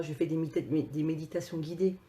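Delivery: usual speaking voice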